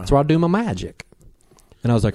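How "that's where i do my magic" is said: higher-pitched Alabama Southern voice; Southern Alabama accent. Retold or imitated. Host quoting the witch on her magic spot, Southern Alabama accent. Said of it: "born in southern Alabama".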